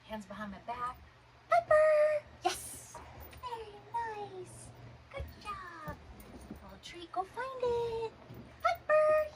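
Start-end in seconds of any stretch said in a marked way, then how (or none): 1.5-2.2 high, loud call of the dog's name. Real, not acted. Calling the puppy by name. 2.4-8.1 high, slow praise for a puppy; high dog praise. Real, not acted. Praising puppy after recall. 8.6-9.3 high, loud call of the dog's name. Real, not acted. Calling the puppy by name.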